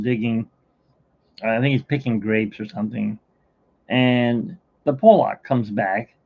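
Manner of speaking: ordinary talk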